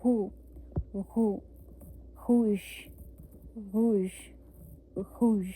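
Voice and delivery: repeated back-of-throat "हुश" drill. Shown as a demonstration. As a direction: push "हुश" hard from the throat. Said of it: "back of the throat कैसे बोलते हैं".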